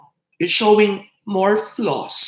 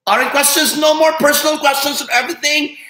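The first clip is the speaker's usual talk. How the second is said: excited; 5 semitones higher, 5 dB louder than usual. Real, not acted. Teasing livestream viewers, laughing.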